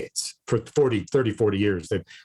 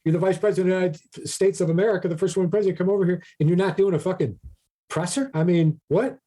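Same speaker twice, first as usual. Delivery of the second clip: mock outrage; 6 semitones higher, faster than usual. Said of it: "They're like".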